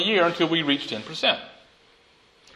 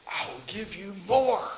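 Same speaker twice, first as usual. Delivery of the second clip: Jesus speaking; 3 semitones higher than usual. Acted. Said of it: "Jesus says to the first and second servant".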